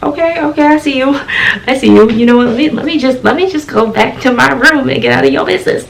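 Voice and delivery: high, softened, taken-aback backing off; mock shock. Student backs away from roommate's door, acting shocked. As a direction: retreat meekly in a high, small voice. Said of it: "I was shocked".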